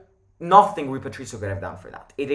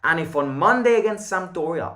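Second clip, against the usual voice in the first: usual speaking voice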